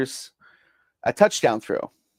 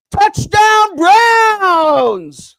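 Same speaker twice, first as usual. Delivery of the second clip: huge drawn-out victory scream, much higher and louder; yelled; 11 dB louder, much slower than usual. Retold or imitated. Fan at home screaming at the TV, drawn-out touchdown yell. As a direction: bellow it like the winning score just landed. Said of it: "And I yelled".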